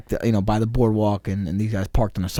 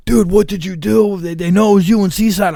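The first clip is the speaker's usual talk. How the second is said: higher, urgent friend's voice on the call; alarmed cop buddy; 9 semitones higher, 4 dB louder than usual. Retold or imitated. Cop buddy calls to warn him: higher alarmed voice. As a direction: warn him he's in trouble. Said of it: "a cop buddy of mine called me".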